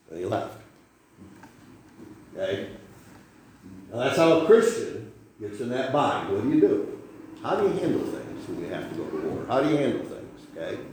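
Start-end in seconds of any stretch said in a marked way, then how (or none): none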